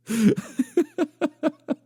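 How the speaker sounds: high, long run of giggles; real cackling laughter. Real, not acted. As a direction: burst out laughing at yourself. Cracks up at own rudeness.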